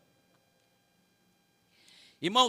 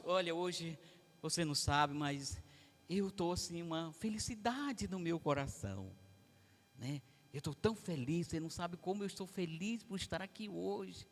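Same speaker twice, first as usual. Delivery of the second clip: soft, lifeless "I'm so happy" with no lift; flatly claiming joy; 5 semitones lower, 12 dB quieter than usual. Shown as a demonstration. Preacher showing the church a joyless way to sound happy. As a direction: say you're happy while sounding bored. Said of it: "Eu ia convencer alguém que eu estou feliz desse jeito".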